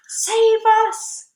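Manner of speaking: high, very loud cry for help; giant's scream. A narrator's reading. Giant begging for rescue in a nightmare, screaming. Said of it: "screamed the flesh lump eater".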